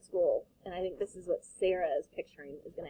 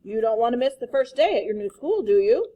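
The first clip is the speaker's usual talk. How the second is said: loud coaxing; 8 dB louder than usual. Acted. Father coaxing child.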